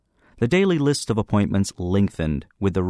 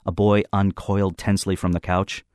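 ordinary delivery, nothing marked

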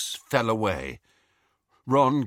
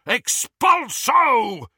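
long drawn-out bellow, much higher and louder; bellowed spell; 13 semitones higher, 6 dB louder, much slower than usual. A narrator's reading. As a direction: roar the curse across the room. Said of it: "bellowed the Death Eater".

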